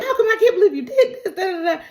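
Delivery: high, rushed half-laughing tirade; mock rant. [name] rants at the couple, fast high mock outrage. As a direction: rattle off an imitated complaint at speed.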